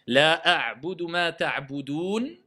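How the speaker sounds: "ta'budun" recited slowly, stopping on the n with no final vowel; slow recitation, lengthened u in "ta'budun", closed on n; stopping on final consonant. Shown as a demonstration. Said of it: "je ne la prononce plus".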